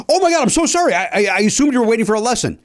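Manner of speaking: high-pitched, gushing retold apology; flustered apology. Apologizing for taking the golfer's bay, flustered.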